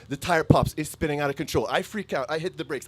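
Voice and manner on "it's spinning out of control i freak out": ordinary talk, nothing marked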